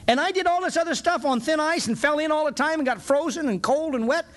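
high, piling up the complaints in disbelief; incredulous question. Retold or imitated. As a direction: keep the voice up, amazed at himself. Retelling astonished prayer to God.

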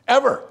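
"Ever" shot up high and hit hard; stressed ever. Real, not acted. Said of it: "would ever say".

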